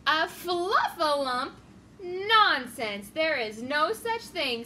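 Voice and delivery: loud, scoffing fluffalump?, slowed down; scornful eagle. Acted. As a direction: fluffalump barked back in loud disbelief. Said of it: "said Eagle".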